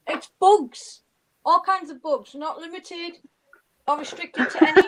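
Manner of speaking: a touch of South London; bugs, in a light South London voice; South London accent. Acted. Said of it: "I do like the way you say bugs".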